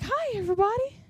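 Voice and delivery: soft, high-pitched cheery greeting; happy lady. Retold or imitated. Happy lady greeting everybody in the salon, cheery imitation. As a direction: imitate someone beaming at the whole room. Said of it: "she's just beaming".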